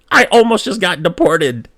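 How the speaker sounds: high, loud mock yell; outraged exclamation. Son tells mom of his near deportation, outraged yell. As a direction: burst out in acted disbelief.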